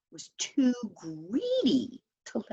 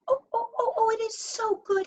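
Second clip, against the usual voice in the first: high, fast delighted gasps; high and quick with delight; faster than usual. A narrator's reading. Lurch tasting delicious juice.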